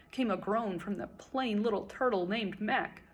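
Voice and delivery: ordinary delivery, nothing marked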